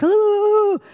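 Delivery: a high, put-on frightened ooh; mock fright. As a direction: act out a little jolt of fright. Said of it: "it's not a fear like".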